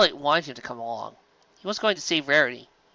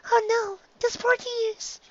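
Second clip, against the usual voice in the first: much higher, slow and hushed worry; gentle worried Fluttershy; slower than usual. Acted. Fluttershy voiced in story.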